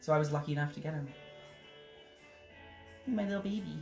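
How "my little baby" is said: ordinary delivery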